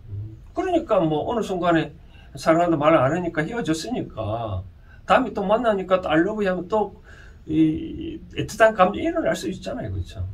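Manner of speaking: ordinary talk, nothing marked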